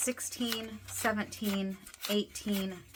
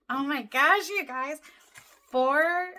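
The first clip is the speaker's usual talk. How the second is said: excited gasp; 8 semitones higher, 6 dB louder than usual. Real, not acted. Counting a big cash total.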